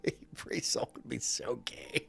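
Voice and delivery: soft whispered aside; whispered joke. Acted. Streamer to chat, sneaking a crude joke, whispered. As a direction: whisper it like a naughty secret.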